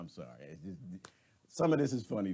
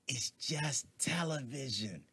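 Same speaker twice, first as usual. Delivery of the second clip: hushed secret; 7 dB quieter, slower than usual. Acted. Confiding the secret slowly.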